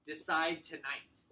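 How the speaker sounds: slow firm order on decide; firm falling command. Shown as a demonstration. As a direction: hit decide, then drop. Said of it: "embedded commands".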